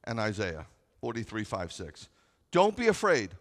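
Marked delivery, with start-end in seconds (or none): 2.5-3.4 loud, high preaching of the verse; full-force pulpit reading; preaching scripture. Acted. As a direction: proclaim it to the congregation.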